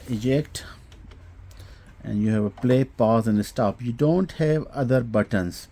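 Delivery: usual speaking voice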